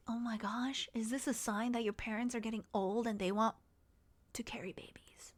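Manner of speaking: half-whispered, very soft aside; mock hushed alarm. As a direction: whisper it like a dreadful realization. Mock hushed dread about parents' wishes.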